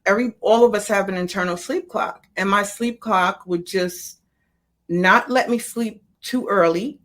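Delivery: normal talking voice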